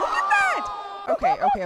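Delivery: very high, loud squeal; excited shriek. Real, not acted. Viewer squealing at a man tumbling downhill. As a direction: let it fly up in shock. Reacting to a wild video clip.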